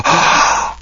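quick airy intake of breath; mock gasp. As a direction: gasp in pretend shock. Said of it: "They gasp, they go".

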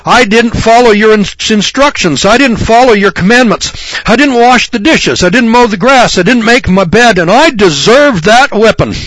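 hammed-up obedient boy owning his faults; a lightly voiced penitent child pleading; mock dutiful son. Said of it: "I never said".

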